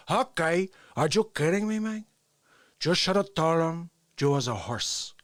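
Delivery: put-on Scarface gangster parody with thick Cuban accent; Tony Montana. Scarface confronting Manny and Sosa, Tony Montana impression. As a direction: swagger through it in gangster dialect. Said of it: "walk around as Tony Montana".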